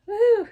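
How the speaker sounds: short, high, loud "woo"; happy whoop. Real, not acted. Vlogger delighted with her two of each, high whoop. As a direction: let out a quick high whoop.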